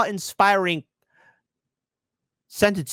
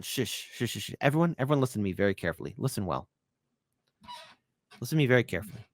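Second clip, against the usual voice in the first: much softer, low conspiratorial hush; 6 semitones lower, 7 dB quieter, faster than usual. Pretend. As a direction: lean in and hush everyone. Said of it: "hushing the audience theatrically".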